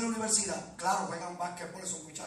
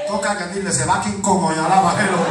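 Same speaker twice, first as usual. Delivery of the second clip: loud, high shouted exhortation; fervent revival preacher shouting for praise; full-force preaching; 3 semitones higher, 18 dB louder than usual. Real, not acted. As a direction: rouse the congregation at the top of your voice.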